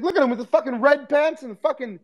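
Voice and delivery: very high mocking comic voice; mock roast. Comic mocking a fan's clothes, high jeering. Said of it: "he's like".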